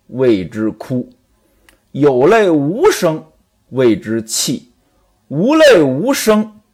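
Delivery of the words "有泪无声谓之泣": normal talking voice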